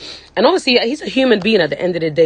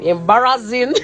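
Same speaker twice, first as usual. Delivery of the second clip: laughing; 4 semitones higher than usual. Real, not acted. Laughs at own crying.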